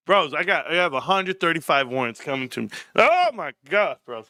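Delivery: normal talking voice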